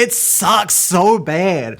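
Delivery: a long, slow moan of disappointment; drawn-out complaint. Real, not acted. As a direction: stretch every word slowly, groaning. Groaning about a bad movie.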